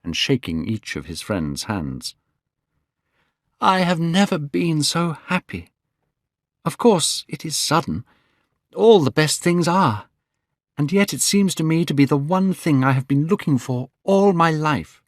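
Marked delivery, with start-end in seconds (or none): 3.6-15.0 Dorian voiced higher, eager and glowing; young Dorian Gray. Dorian telling his friends he is in love, voiced higher. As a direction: lift the voice for a young man in love. Narrator voicing Dorian, raised pitch.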